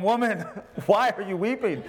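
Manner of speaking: chuckling through a mock husband's question; playful husband voice, laughing; laughing mock line. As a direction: tease it while laughing. Mock husband voicing at home.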